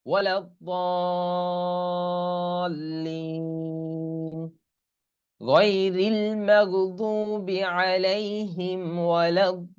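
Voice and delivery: slow, drawn-out Quranic chant with long vowels; Quran recitation. Shown as a demonstration. Teacher models the verse for students, slow tajweed chant. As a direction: chant it slowly like tilawat. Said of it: "teacher recites Fatiha verse".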